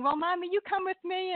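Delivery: put-on a light, high little-girl voice; little daughter. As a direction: lightly imitate a small girl coaxing her mom. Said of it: "a little daughter's going".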